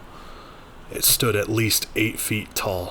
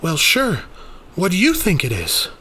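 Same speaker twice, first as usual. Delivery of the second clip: higher, louder offhand reply; Rainey, casual; 9 semitones higher, 6 dB louder than usual. Acted. Rainey answering Daniel, breezy. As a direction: shrug it off easily.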